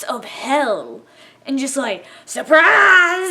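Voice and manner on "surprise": high, loud burst, jumping out; gleeful ambush shout. Retold or imitated. Reaper pouncing on the player, mock triumphant shout. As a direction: shout it as a monster springing a trap.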